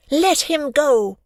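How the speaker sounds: put-on girl ordering the bully to release the boy, loud and slow; commanding shout. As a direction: draw a line the bully cannot cross.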